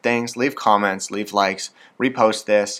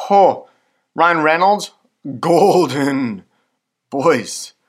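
excited gushing; 6 semitones higher, 5 dB louder, much slower than usual. Real, not acted. Fangirling over celebrity crushes.

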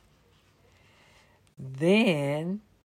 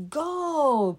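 a much higher, louder cry of delight; excited cry; 10 semitones higher, 5 dB louder than usual. Acted. Bunny crying out on spotting the gold coins. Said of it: "cried the bunny".

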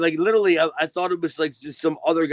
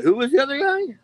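high, louder mock bewilderment; puzzled reaction; 11 semitones higher, 4 dB louder than usual. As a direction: act out being baffled, pitch leaping up. Retells own puzzled reaction to clip.

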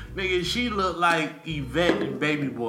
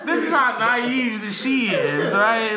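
laughing; 9 semitones higher, 6 dB louder, slower than usual. Real, not acted. Laughing about naive girlfriend.

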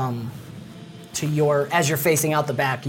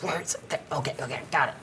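mumbling; 9 dB quieter, faster than usual. Acted. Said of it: "He's, like, mumbling to himself".

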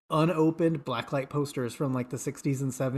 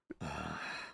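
low, long weary sigh; heavy sigh; 12 semitones lower, 14 dB quieter than usual. Retold or imitated. Villain told to run in place, sighing in defeat. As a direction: let out a big defeated sigh.

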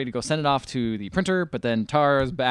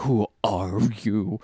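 slow low character voice; 4 semitones lower, 3 dB quieter, much slower than usual. Retold or imitated. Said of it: "he's like".

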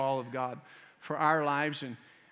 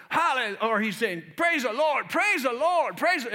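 joyful shout; 8 semitones higher, 7 dB louder than usual. Retold or imitated. Rider joyful at full gallop.

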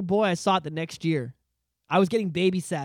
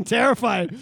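usual speaking voice

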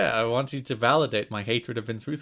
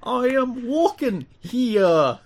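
high, very slow hammed-up fantasy voice; mystical character; 10 semitones higher, 3 dB louder, much slower than usual. Host turns New York catchphrase fantasy, high mystical mock voice. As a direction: stretch it out like a mock role-player. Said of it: "the voice he gave whatever mystical character".

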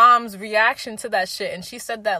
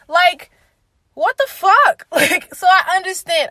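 incredulous outcry; 8 semitones higher, 8 dB louder, slower than usual. Real, not acted. Reacting to parents' questions.

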